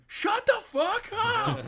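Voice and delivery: high, drawn-out shout; mock angry yell. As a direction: yell it like a neighbor banging on the wall. Acted neighbor banging on wall.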